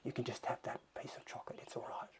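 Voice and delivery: whispered inner voice of temptation, fast; little tempting voice. Retold or imitated. Inner voice whispering to Malcolm to have the chocolate. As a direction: hush it like a sly voice coaxing you toward chocolate. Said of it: "there's a little voice that says".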